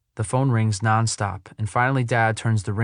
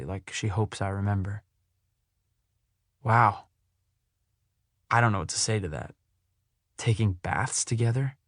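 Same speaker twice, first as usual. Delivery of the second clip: plain reading voice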